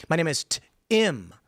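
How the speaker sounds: "T-Im" split into T, then a short "im"; name sounded out. Retold or imitated. Teaching someone to say the name, "T-Im" split slowly. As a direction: break "T-Im" in two, slowly. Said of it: "It's an I, not an E".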